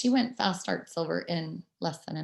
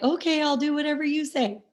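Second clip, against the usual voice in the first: meek owner; 8 semitones higher than usual. Retold or imitated. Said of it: "And you're like".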